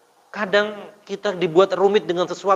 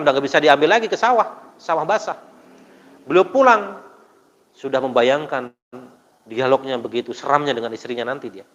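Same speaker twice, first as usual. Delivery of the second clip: normal talking voice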